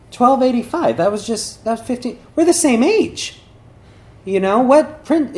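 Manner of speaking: high, thrilled working-out aloud; excited realization. Retold or imitated. Prince working out the princess's age, excited realization.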